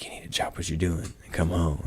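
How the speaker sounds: imitating the vet in a hushed whisper. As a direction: whisper the vet's order. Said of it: "She's like".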